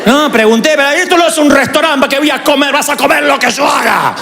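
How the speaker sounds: voz de mamá que sube hasta un grito; madre firme. Retold or imitated. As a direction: imita a su madre imponiendo la comida. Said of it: "¿O la mía era?". (la madre del pastor, firme y gritando al final)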